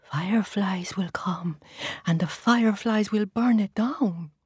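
scared hushed whisper; fearful whisper. Acted. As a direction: whisper it in fear. Tortoise tells his fear.

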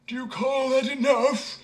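a huge drinker scoffing; thirsty man answering the simpleton. A narrator's reading. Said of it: "answered the other".

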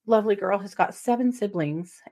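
ordinary talk

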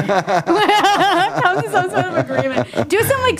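high giggly voice; laughing. Real, not acted. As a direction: giggle through the words.

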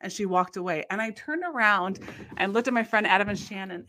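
ordinary delivery

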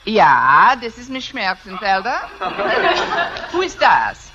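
hammed-up German woman on the phone; put-on German accent. Miss Brooks posing as Miss Schmerzenfelder, put-on German accent. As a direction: answer with a mock German accent. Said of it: "If I can do a decent German accent".

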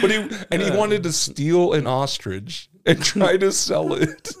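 slow words squeezed through laughter; real giggling recap; laughing while talking. Real, not acted. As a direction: barely get it out while laughing. Still laughing at the story.

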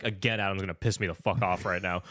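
ordinary talk

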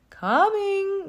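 high, loud, drawn-out moo; mooing cow. A narrator's reading. Cow runs to her tree, mooing. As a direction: sing it out like a cow. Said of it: "mooed Abigail".

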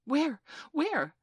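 high, loud, eager questions; excited. A narrator's reading. Children searching for the island, excited. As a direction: call out eagerly, pitched well up.

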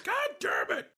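high, shouted mock yell. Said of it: "You yelling is like".

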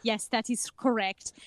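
put-on deadpan German-accented young woman. Young teacher introducing herself, German accent. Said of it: "that's the only German accent I can do".

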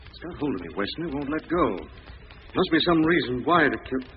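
normal talking voice

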